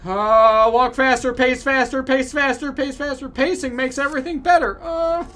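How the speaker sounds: high, loud hurried commands; frantic urging. Pretend. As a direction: hurry yourself along. Frantic urging to pace faster.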